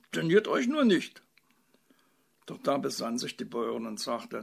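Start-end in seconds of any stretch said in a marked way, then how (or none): 0.1-1.2 high, loud, eager encouragement; eager urging. A narrator's reading. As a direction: coax with gleeful anticipation. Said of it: "rief der Bauer und rieb sich erwartungsfroh".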